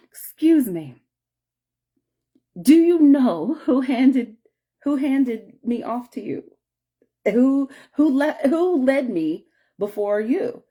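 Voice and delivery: re-enacted eager question; earnest inquiry. Approaching a stranger to ask.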